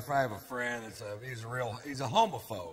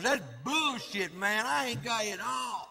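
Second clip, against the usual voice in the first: homophobic friend quote; 7 semitones higher than usual. Retold or imitated. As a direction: protest like the offended buddy. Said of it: "He goes".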